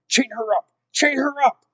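high, loud order, escalating; shouted. A narrator's reading. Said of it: "shouted Roscuro".

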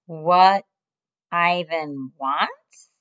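slow, drawn-out disbelieving repeat. Acted. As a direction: repeat each word slowly, doubting. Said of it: "he repeats slowly".

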